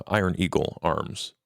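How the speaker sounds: normal talking voice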